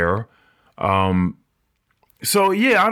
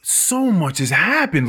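higher, slow wonder; awed and slow; 7 semitones higher than usual. Retold or imitated. The immortal man tells Dream of the century, slow and awed. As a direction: savour it in wonder. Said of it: "he's just like".

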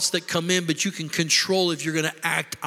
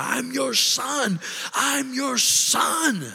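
high-pitched, drawn-out indignant cry; retold scream; 8 semitones higher, much slower than usual. Angry son screaming at God in his car, acted-out high scream. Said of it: "I screamed at him and said".

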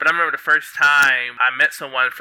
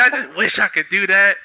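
laughing mimicry of friends; 6 semitones higher than usual. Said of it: "They're like".

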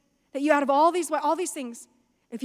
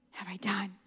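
a breathless, guilty whisper; stunned whisper; 5 semitones lower, 10 dB quieter than usual. Retold or imitated. Sinner realizing his wrong, in a stunned whisper. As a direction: whisper it, horrified at yourself.

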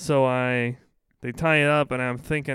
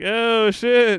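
high, loud "oh shit"; mock shocked; 9 semitones higher, 7 dB louder than usual. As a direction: yelp it in fake shock. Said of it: "It's like".

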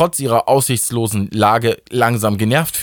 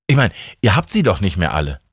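ordinary delivery

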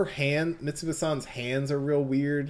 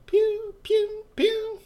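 pew pew pew squeaked very high and loud; high pew pew gunfire; 4 dB louder, much slower than usual. Acted. GM mimicking rock bits shot from fingertips, squeaky pew sounds. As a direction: fire off tiny high-pitched pew noises.

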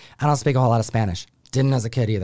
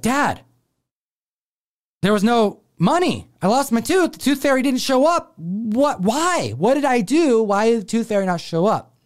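put-on high, pleading kid voice; a little boy upset the tooth fairy never came; 9 semitones higher than usual. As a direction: whine it like a kid who got nothing.

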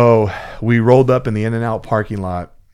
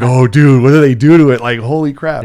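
loud, high burst of retold panic; worked-up disbelief; 4 semitones higher, 12 dB louder than usual. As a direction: blurt it out, reliving the nerves. Retells his nervous reaction, hands sweaty.

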